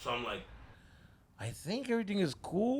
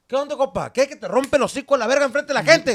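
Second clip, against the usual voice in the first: loud, high, rapid-fire mock dad rant; angry dad scolding; 9 semitones higher, 12 dB louder, faster than usual. Dad chewing out his son in front of people, loud imitated scolding.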